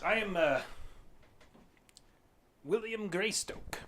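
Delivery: slow, high, grand self-introduction; eccentric British lord. Acted. Lord introduces himself to strangers, slow and grand. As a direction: savour your own title with British airs.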